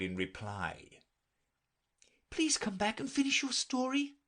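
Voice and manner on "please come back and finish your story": put-on high girl's entreaty; calling after. A narrator's reading. Alice calling after the departing mouse, entreating. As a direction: call out lightly, pitched high. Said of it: "Alice called after it".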